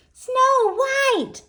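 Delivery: put-on high, loud girlish squeal of delight; gushing. A narrator's reading. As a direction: gush over your absolute favourite. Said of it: "gushed the girls".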